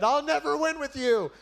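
high, loud dramatic cry; hammed-up parting shout; mock exasperation. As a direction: yell it high in pretend defeat. Jonah's imagined outburst off the cliff.

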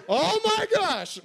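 mock shock. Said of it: "Like, there's this".

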